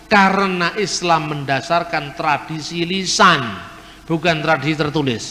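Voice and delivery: ordinary talk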